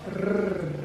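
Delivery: long trilled Spanish R; rolled R. Shown as a demonstration. Mother showing kids the Spanish R, "Rrr" held in a roll. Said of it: "Can you roll your R?".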